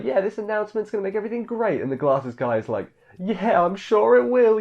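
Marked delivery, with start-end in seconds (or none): 0.0-1.8 high, quick imitation of naive believers; mock optimism. Crowd cheering on the coming announcement, mock optimistic. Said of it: "everyone else is all optimistic". 3.2-4.6 high, drawn-out mock agreement; sarcastic. Glasses guy doubting the optimists, imitated sarcasm. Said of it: "you know, all sarcastic".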